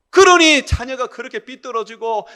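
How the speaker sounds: loud, high-pitched sermon delivery; forceful preaching. Real, not acted. Preacher warning children who mistreat parents, forceful preaching. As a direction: drive the warning home to the congregation.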